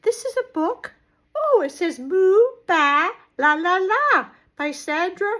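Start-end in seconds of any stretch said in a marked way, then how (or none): none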